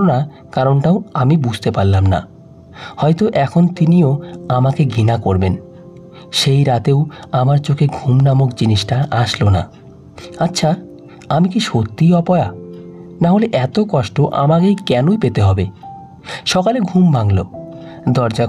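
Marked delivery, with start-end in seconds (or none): none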